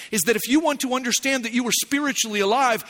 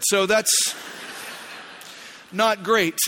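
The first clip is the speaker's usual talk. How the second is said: ordinary talk, nothing marked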